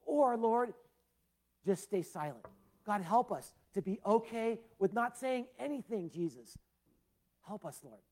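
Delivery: a pastor praying over his congregation, earnest and lifted; pastor's prayer. Real, not acted. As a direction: lead the church in prayer.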